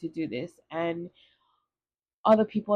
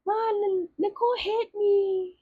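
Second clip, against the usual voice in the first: put-on much higher whiny child's tattle; tattling little kid; 14 semitones higher than usual. Little sister tattling to mom on older sister, whiny high kid voice. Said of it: "And so I'd be like".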